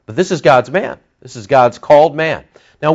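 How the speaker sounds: normal talking voice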